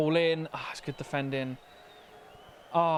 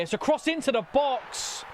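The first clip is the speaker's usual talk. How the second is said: higher, rising call of the cross; excited commentary; 9 semitones higher than usual. Real, not acted. Manager watching his side on attack, excited call. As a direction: call the cross as the chance builds.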